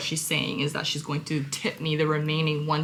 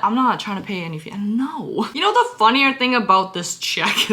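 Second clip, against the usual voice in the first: ordinary delivery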